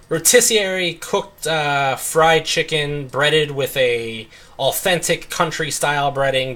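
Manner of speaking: hammed-up restaurant menu description; menu sales pitch. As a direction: sell the dish like a fancy menu blurb. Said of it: "we're trying to sell you on this".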